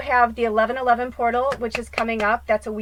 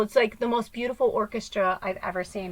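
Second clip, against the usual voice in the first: ordinary delivery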